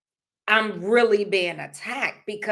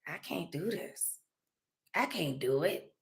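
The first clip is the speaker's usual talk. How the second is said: much softer, worn-out I can't; defeated murmur; 4 semitones lower, 12 dB quieter than usual. Retold or imitated. Recalling giving up on filming, soft and defeated. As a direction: let it sink quietly, giving up. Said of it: "I just was like".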